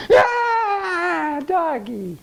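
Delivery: put-on high wailing child's cry, then sobbed "doggy"; mock a toddler bursting into tears; little kid crying. Coach imitates a little kid going from laughter to tears, high wail. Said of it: "a little kid can go from".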